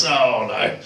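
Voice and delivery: low, subdued apologetic voice; losing prisoner. Acted. Prisoner owing a cellmate after a lost game, low apology. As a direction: say it low and sheepish, as a loser.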